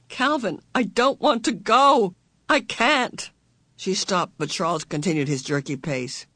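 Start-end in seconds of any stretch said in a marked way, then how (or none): 0.1-3.3 frightened protest. A narrator's reading. Meg refuses in fear.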